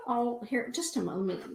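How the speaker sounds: ordinary delivery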